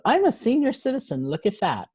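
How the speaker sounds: sudden high-pitched start of surprise, easing down; retold jolt of self-recognition; startled realization. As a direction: recall being taken aback by your own thought. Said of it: "I had a kind of startled moment".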